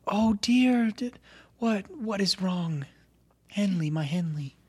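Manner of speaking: concerned mother. Acted. Mom sees daughter break down.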